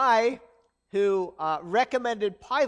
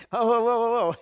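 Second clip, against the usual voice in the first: alarmed Pilate; 2 semitones higher, 9 dB louder than usual. Acted. Pastor voices alarmed Pilate.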